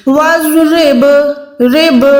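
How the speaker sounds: وَاضْرِبْ ending in a bouncing qalqala ب; رب with a ringing bounce on ب; echoing ba. Shown as a demonstration. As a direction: let the ب in وَاضْرِبْ echo. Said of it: "لرزش آواز میں آنی چاہیے".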